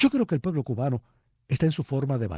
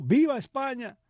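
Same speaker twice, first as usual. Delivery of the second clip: much higher mock cry of Viva España; retold shout; 10 semitones higher than usual. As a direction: answer back from the crowd, pitched up. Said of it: "otra gritó". (grito de respuesta, más agudo)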